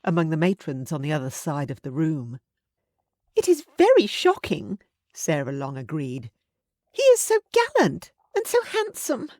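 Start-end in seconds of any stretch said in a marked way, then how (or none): 3.3-4.8 put-on much higher, louder scandalized young voice; gossiping girl. A narrator's reading. 6.9-9.3 put-on much higher, louder scandalized young voice; gossiping girl. A narrator's reading.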